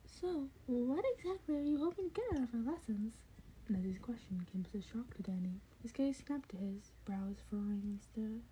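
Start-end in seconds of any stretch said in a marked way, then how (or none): 0.1-3.1 high, curious creature voice; eager high questioning; curious Nezu. A narrator's reading. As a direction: probe him like a keen little principal.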